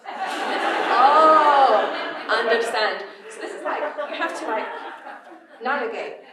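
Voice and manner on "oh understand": dad understanding. Retold or imitated. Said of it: "dad on the phone".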